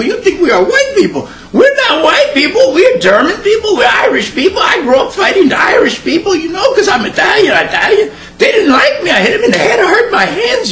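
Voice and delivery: a hammed-up excitable immigrant ranting and cackling; Mexifornia accent. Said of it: "kinda like Mexifornia".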